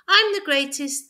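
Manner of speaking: high, loud boastful shout; hare shouting. A narrator's reading. The hare boasting to the wood, shouting high and loud. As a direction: crow it out like a proud hare. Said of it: "the hare shouted".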